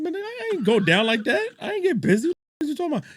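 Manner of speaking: high-pitched, indignant mock protest; indignant retort. Streamer retelling his reply to a chatter, mock-indignant. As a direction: act out an affronted refusal way up high.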